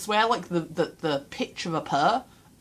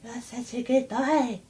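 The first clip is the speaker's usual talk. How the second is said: higher, gentler cooing pet talk; cat voice; 7 semitones higher, slower than usual. Acted. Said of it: "in my cat voice".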